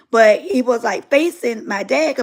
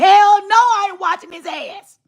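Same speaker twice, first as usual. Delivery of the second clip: dad yelling from background; 8 semitones higher, 5 dB louder than usual. Retold or imitated.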